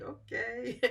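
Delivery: soft okay between laughs; amused okay through laughter. Real, not acted. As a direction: laugh it off quietly. Laughing at robotic phone talk.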